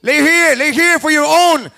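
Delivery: loud, slow hometown hype call. Real, not acted. Hype host calling hometown fighter.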